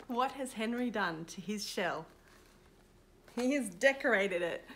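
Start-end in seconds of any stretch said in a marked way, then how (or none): none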